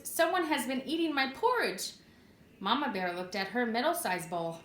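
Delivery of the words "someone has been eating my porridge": Papa Bear's loud cry. A narrator's reading. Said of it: "He cried out in a loud voice".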